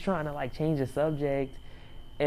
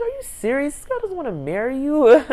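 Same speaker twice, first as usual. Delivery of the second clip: high, loud disbelief building; incredulous outburst; 11 semitones higher, 8 dB louder than usual. Retold or imitated. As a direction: cry out as if talking sense into him. Her retold outburst at his foolishness.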